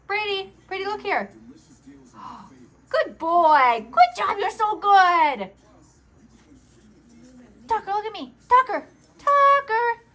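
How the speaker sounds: high, loud pet talk; talking to a dog. Real, not acted. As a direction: coo and call to the dogs. Calling, praising dogs.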